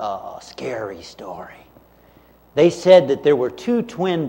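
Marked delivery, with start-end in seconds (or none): 0.0-1.7 hushed scary-story voice; spooky whisper. Acted. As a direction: whisper it like a campfire tale. Telling a scary camp story.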